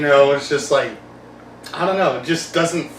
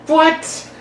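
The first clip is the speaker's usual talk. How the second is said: high, slow baffled what; incredulous what; 12 semitones higher than usual. Real, not acted. Podcaster scoffing at a movie death, high and slow. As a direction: stretch the disbelief out. Disbelief at Bane shot.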